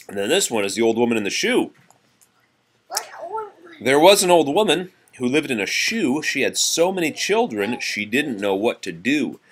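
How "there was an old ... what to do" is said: raised, lively nursery rhyme reading; reading to children. Acted. Parent reading nursery rhymes to child, lively. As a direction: read it out brightly for a child.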